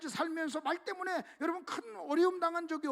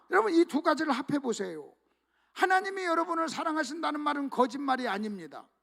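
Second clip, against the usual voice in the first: ordinary talk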